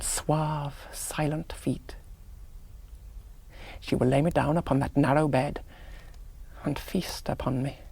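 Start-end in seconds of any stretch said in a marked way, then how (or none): 0.0-2.0 hushed breathy whisper, slowing. Acted. Officer imagining her visit, dropping to a whisper. Hushed erotic fantasy of the vampire. 6.6-7.8 soft unvoiced whisper. Officer picturing the countess feeding, whispered. Hushed erotic fantasy of the vampire.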